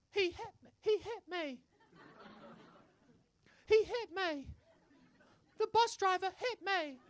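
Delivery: put-on high-pitched little English kid voice; whining British child. Child tattling on the bus driver, high whine. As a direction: squeak it out as a lying child's complaint. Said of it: "this child comes out of the doors".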